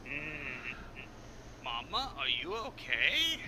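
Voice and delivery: slow, drawn-out question to Mama; worried child character. Acted. Game master voices a son checking on Mama, slow and concerned. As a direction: ask it slowly, like a concerned child. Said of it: "it's like".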